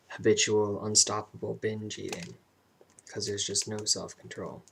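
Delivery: ordinary delivery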